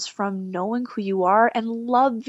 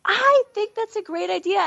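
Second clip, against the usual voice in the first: enthusiastic; 10 semitones higher than usual. Real, not acted. Enthusiastic reply to idea.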